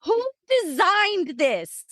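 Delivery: high, slow mock scream with a laugh in it; imitating her own desperate yelling; retold screaming. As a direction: act out yelling in labor, half laughing. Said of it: "literally screaming".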